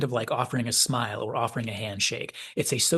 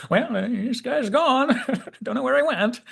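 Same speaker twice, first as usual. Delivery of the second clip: high-pitched, chuckling bewilderment; laughing mock report; 12 semitones higher, 4 dB louder than usual. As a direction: imitate a stunned officer, cracking up. Jokey voicing of dumbfounded officer's report.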